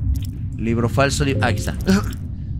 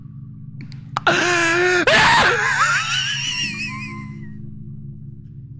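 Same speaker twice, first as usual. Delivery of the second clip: high wailing laugh-scream; laugh-cry scream. Real, not acted. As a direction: howl between laughing and crying.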